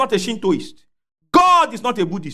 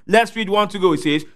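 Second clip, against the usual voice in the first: usual speaking voice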